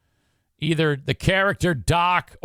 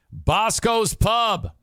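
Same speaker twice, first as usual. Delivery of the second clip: Bosco's Pub drawn out, higher; ad read; 6 semitones higher, much slower than usual. Acted. Host plugging his friends' pub, slow ad read. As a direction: sell the name slowly.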